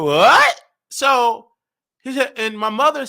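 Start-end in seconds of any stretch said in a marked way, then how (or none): 0.0-0.6 loud, swooping mock "what"; shocked outburst. Mother hearing of the pregnancy, shocked outburst. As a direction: imitate an incredulous yelp.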